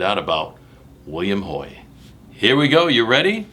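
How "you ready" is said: ordinary delivery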